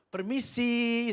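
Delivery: high, drawn-out mock call; loud calling out. Preacher walks up to the gang, loud drawn-out excuse-me. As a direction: sing it out loudly, stretching the word. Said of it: "saya bilang gitu".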